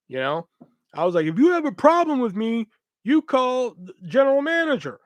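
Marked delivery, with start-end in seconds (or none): 1.3-5.0 high, raised-voice retort, short of a scream; retold screaming. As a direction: re-enact an angry yell, half-strength. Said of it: "I start screaming at him".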